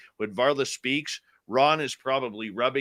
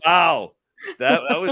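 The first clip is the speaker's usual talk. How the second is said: high, loud amazed wow; surprised; 6 dB louder than usual. Real, not acted. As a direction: sound genuinely amazed. Amazed at the coincidence.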